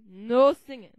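loud scolding no. Acted. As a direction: forbid it firmly.

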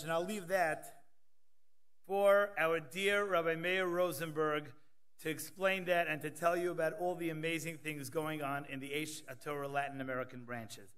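usual speaking voice

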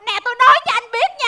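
fast, heated phone scolding; angry confrontation. Acted. Wife confronts husband on the phone, fast and angry. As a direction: tear into him without pausing.

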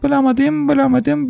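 ordinary delivery

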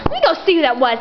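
normal talking voice

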